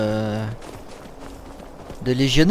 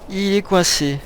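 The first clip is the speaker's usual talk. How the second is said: higher-voiced, incredulous repeat; exasperated repeat; 3 semitones higher, slower than usual. Real, not acted. Repeats it in disbelief.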